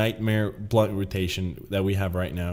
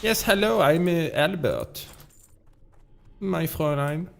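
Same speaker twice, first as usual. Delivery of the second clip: put-on higher-pitched German accent drifting into Swedish or French; Albert, botched German accent; 6 semitones higher than usual. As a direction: try German and miss, pitched up. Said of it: "turns into either Swedish or French".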